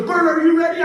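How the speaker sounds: high, loud host call; hype announcer. Retold or imitated. Luau host calls out to comedian in audience, shouted announcer voice. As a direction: shout it across a crowd.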